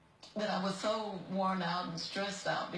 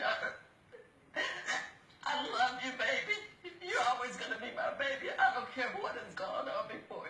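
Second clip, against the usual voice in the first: real tearful plea; 5 semitones higher than usual. Real, not acted. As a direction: voice breaking with sobs, pitched higher. Dying mother breaks down over daughter.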